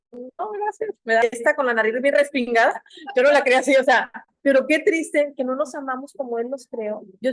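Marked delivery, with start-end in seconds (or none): 0.1-0.9 high, soft little refusal; softly pitched-up mock refusal; acted polite refusal. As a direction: decline lightly in a small, raised voice. Acts out declining an offered gift.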